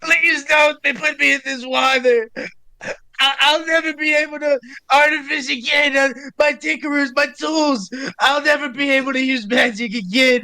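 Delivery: high-pitched, sobbing plea of a terrified gnome; crying gnome. Acted. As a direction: wail it in a high, tearful put-on voice. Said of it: "The gnome starts crying".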